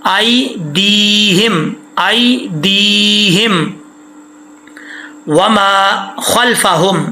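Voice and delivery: slow, stretched Quranic recitation; drawn-out recitation. Shown as a demonstration. Teacher shows students the correct reading, slow recitation. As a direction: stretch each syllable like a reciter. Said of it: "ایدیہم پڑھیں گے غلطی ہو جائے گی".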